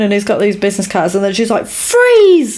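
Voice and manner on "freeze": high-pitched mock shout; retold police-style yell, high and sudden; shouted command. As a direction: imitate an agent calling out a sudden order, pitched way up. Agent's command at gunpoint, retold.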